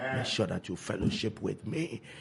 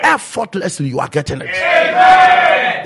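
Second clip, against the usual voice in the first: high, loud, slow shout; 13 semitones higher, 17 dB louder, slower than usual. Acted. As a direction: shout it out slowly. Repeats the line, then shouts it.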